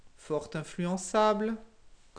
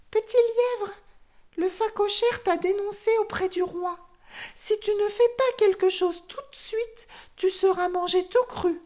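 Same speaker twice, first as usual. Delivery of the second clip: very high, urgent bird voice; little guinea fowl; 13 semitones higher, 5 dB louder than usual. A narrator's reading. The guinea fowl warns her friend the hare, very high. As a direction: chirp out the warning in a rush.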